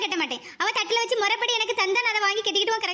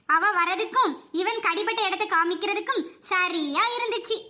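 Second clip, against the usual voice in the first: usual speaking voice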